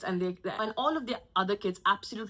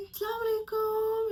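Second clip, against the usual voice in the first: pitched way up, over-polite salaam; mock polite greeting; 13 semitones higher than usual. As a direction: greet her with fake politeness. Narrator greets the aunt.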